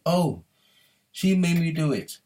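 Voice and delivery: high-pitched mock excuse-making; mock feminine whine. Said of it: "He did a feminine".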